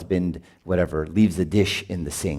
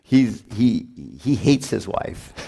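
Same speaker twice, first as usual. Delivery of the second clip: ordinary talk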